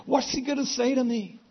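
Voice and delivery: acted fright, pitched very high; high, anxious worry, acted out; terrified woman. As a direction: voice her panic in a high tone. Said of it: "and she's terrified".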